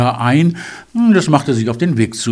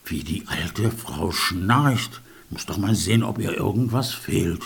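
hunter thinking; 6 semitones lower, 7 dB quieter than usual. A narrator's reading.

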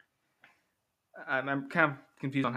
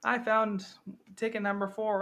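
put-on excited kid; 9 semitones higher than usual. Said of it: "all the kids who won".

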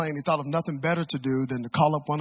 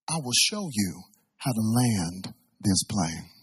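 softer, measured, calming controller; control tower voice; 5 dB quieter than usual. Retold or imitated. Control tower guiding a frightened passenger, calm soft voice. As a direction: talk someone down from panic, quietly and deliberately. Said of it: "The voice from the control tower".

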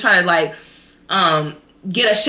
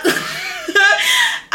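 high shrieking laugh of disbelief; high disbelieving laugh. Real, not acted. Laughing in disbelief at her own success. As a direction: squeal with laughter.

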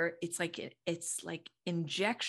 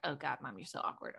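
put-on whispered kid; 5 semitones lower, 8 dB quieter, faster than usual. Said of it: "or like".

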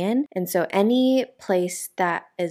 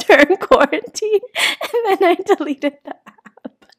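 laughing; 11 semitones higher, 9 dB louder than usual. Real, not acted. Laughing at her own story.

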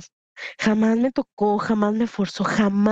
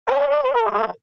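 sudden high furious cry, then a slower growl; hammed-up retelling of an enraged father; dad's outraged roar; 8 semitones higher, much slower than usual. Father raging about the man who hurt his daughter, furious yell. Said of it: "él como papá así de".

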